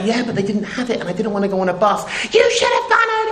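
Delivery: high, shrill-pitched mock tirade, rising to a shriek; mock screaming wife. Neighbour imitates the perfect wife screaming at her husband. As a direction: play the furious wife, voice climbing high.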